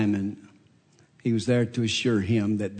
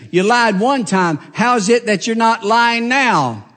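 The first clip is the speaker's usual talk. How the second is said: louder and higher, hammering the charges; accusing prosecutor; 5 semitones higher, 8 dB louder than usual. Acted. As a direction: grill the witness like a prosecutor. Prosecutor accuses Peter in court.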